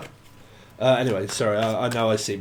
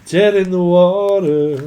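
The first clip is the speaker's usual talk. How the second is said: booming announcement; 3 semitones higher, 7 dB louder, slower than usual. Pretend. Channelled spirit message announced.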